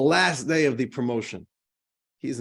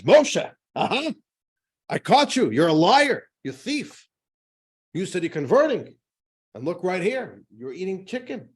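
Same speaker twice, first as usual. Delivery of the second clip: higher, louder triumphant accusation; a priest catching his convert in the act, higher and louder; accusing priest; 5 semitones higher, 5 dB louder than usual. Acted. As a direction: bust him red-handed, gleeful and loud. Priest barges in and catches him.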